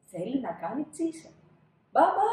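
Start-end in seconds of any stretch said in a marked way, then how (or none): 1.9-2.3 high, loud call for dad. Acted. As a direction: call out like a child. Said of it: "φωνάζει ο Άλφονς". (ψηλό δυνατό φώναγμα)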